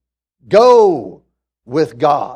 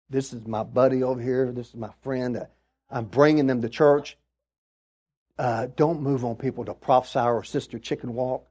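soft, low private plea to God; mock anxious prayer; 7 semitones lower, 10 dB quieter than usual. Churchgoer praying with a visiting friend beside them, hushed and low. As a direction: pray under your breath, comically pleading. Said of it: "that's the day you're saying".

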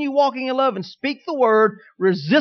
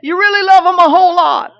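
much higher and louder, gushing with love; 9 semitones higher, 11 dB louder than usual. Shown as a demonstration. Preacher charting love to congregation, gushing peak. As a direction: gush it out like a smitten spouse.

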